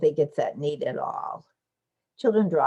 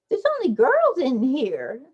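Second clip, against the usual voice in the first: put-on higher and louder, a bossy little girl; high insistent child voice; child's voice; 7 semitones higher, 5 dB louder than usual. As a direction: insist like a preschooler. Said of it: "says Odette".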